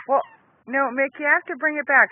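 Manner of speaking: ordinary talk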